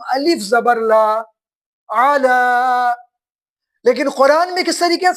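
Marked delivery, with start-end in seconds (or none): 1.9-2.9 علا said very slowly with a long final aa; drawn-out reading. Shown as a demonstration. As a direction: model علا with the vowel held long. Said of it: "اس کی جو آواز ہے وہ اس طریقے سے ہوگی".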